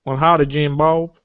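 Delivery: deliberately embarrassing slow Southern drawl; slow, cartoonish Southern US accent; bad Southern accent. Shown as a demonstration. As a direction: do a hammed-up Southern yokel accent. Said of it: "a deliberately embarrassingly southern accent".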